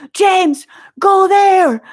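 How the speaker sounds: high, loud retold shout; urgent calling out. Spotting toilets for a panicking friend, urgent shout.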